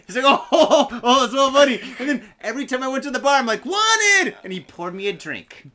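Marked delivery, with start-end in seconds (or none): 0.2-1.8 loud, higher, laughing exclamation; hammed-up laughing reaction; imitated amusement. Imitating bartender finding it hilarious. 3.6-4.3 high, loud mock shout; hammed-up wanted-poster cry; calling out. Acting out calling to the bartender.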